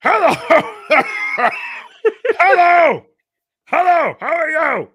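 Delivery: very high, loud strained squawk; rough strange voice. Acted. Alan greeting the visiting team, rough shouted screech. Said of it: "rough voices that need lozenges".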